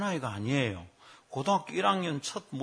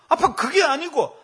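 high, loud, slow whine to dad; mock girlish complaint; imitated daughter; 11 semitones higher, 8 dB louder than usual. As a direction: protest slowly as the daughter. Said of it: "그랬더니 우리 딸입니다".

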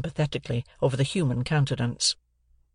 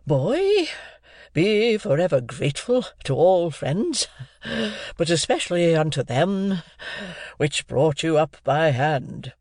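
slow, higher pompous declaiming; pompous speech; 4 semitones higher, slower than usual. A narrator's reading. Pumblechook lecturing the boy pompously. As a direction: deliver it like a sheriff's sermon. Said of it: "let off upon me the speech".

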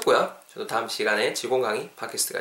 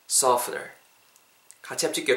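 ordinary talk

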